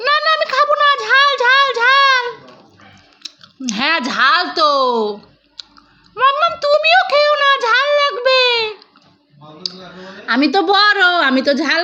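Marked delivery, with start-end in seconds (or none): 0.1-2.2 put-on much higher, a small child begging for the balloon; toddler voice. Mother voicing her toddler asking for the balloon, high childlike plea. 6.2-8.4 put-on much higher, a small child begging for the balloon; toddler voice. Mother voicing her toddler asking for the balloon, high childlike plea.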